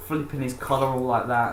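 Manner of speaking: ordinary delivery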